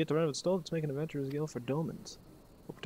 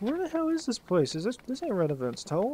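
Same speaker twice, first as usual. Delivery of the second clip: much higher, louder lost and baffled; confused disbelief; 9 semitones higher, 4 dB louder than usual. Retold or imitated. Player retelling his confusion at the strange place, high baffled squeak. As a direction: squeak out where you are like you're totally lost. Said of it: "I was like".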